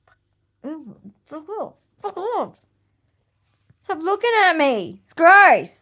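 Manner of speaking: building from whisper to loud snap; telling boys off. Retold or imitated. A girl yelling at teasing boys, whisper growing to loud. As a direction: start hushed, end shouting them off. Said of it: "I was like".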